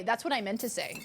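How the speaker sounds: ordinary talk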